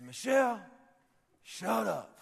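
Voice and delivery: mock shout in a high preacher voice; Pastor Rick. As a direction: imitate your pastor snapping at his wife, loudly. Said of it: "This is my best Rick impression".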